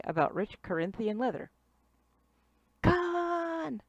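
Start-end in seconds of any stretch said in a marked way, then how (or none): 2.8-3.8 high, drawn-out cry; mock yell. Captain cries after escaping Khan, mock yell. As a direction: stretch the villain's name out without full volume.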